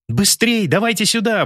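high urgent shout. Acted. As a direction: call them out fast. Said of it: "выкрикивала Анка".

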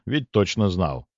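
usual speaking voice

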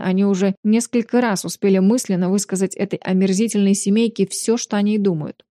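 ordinary delivery

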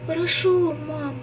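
high, sleepy mumble; sleep talking. A narrator's reading. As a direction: mumble it half asleep. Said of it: "пробубнила она".

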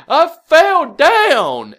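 loud, high, drawn-out wail; mock crying. Retelling her tearful excuse after the soaking, mock wailing. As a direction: wail it slowly, imitating a crying child. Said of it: "starts crying".

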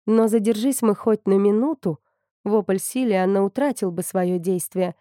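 plain reading voice

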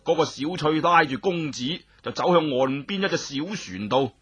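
ordinary delivery

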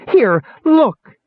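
high, loud insistence; outraged little dwarf. A narrator's reading. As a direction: demand attention in a squeaky shout. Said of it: "Bupu screamed in outrage".

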